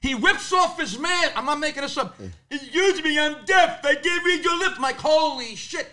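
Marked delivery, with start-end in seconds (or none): 2.5-4.8 higher, different voice for the deaf pharmacist; imitated deaf pharmacist; deaf man's voice. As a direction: voice the pharmacist apologizing.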